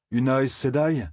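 a touch of bitter scorn on Aes Sedai, falling. A narrator's reading. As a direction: Aes Sedai said dry and bitter, voice dropping at the end. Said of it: "d'un ton amer". (une pointe d'amertume sur «Aes Sedai»)

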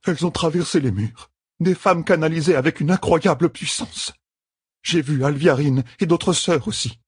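high-pitched, urgent sister's account; shaken witness. A narrator's reading. Sister describing the attackers to her leader, shaken.

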